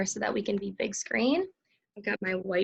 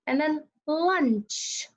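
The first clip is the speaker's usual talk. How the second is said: slow dictation; 6 semitones higher, much slower than usual. Shown as a demonstration.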